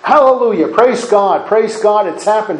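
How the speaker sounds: high, loud, joyful cry of victory; preacher voices heaven celebrating, jubilant shout; jubilant praise. Acted. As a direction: cheer like heaven just saw the win. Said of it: "in heaven they're going".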